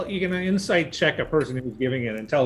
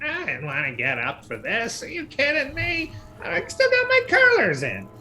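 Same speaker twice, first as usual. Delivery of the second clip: grumbling wife, climbing high; indignant complaint rising to a high screech; bickering couple; 14 semitones higher than usual. Acted. Spider wife complains about getting up, bickering and rising high. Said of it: "doing a little bit of the Bickersons".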